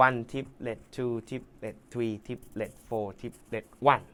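very slow, quiet triplet count; counting along slowly and softly for the student. Shown as a demonstration. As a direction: spell out each triplet slowly. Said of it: "เราต้องเล่นอีกห้องหนึ่ง".

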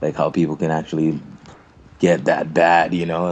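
ordinary talk

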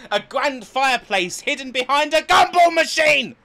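high, loud hilarity; incredulous outburst. Real, not acted. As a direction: exclaim it between fits of laughter. Cracking up at the reviewed hotel.

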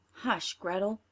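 plain reading voice

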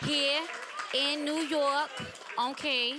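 put-on playful New York accent; hint of a New York accent. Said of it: "Is that your New York accent?".

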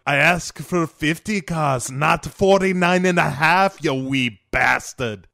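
angry Scottish villain. Retold or imitated. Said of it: "he's like".

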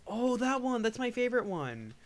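sudden high, loud delight; light up at the memory; excited. Real, not acted. Recognizes favorite song.

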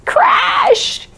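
high, sudden crash shout; excited shout. Acted. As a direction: yell it like a crash. Acting out ski crash.

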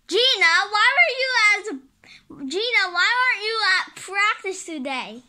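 loud, high angry phone scold; a mother bawling out her daughter; scolding mom. Acted. Mom yelling at Gina over the phone, loud and angry.

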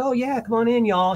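a hint of a Southern Atlanta host waving them in; Southern accent. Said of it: "She's a Southern girl from Atlanta".

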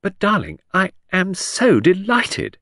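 high, fluttery delight; gushing Lady Angkatell. Acted. Aristocratic hostess gushing to Midge. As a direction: gush over her with high delight. Lucy greets Midge effusively.

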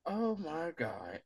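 soft and slow, dawning regret; hushed realization of own mistake; quiet slow dismay. Retold or imitated. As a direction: let the guilt sink in softly and slowly.

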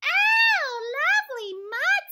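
very high squealing duck cry; squeaky shouting duck. A narrator's reading. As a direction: shriek with delight like a duck. Duck character in read-aloud.

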